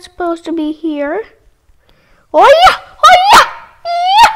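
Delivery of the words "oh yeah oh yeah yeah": high, loud whoops of joy; cheering. Pretend. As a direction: cheer like you just won. Cheering in the game.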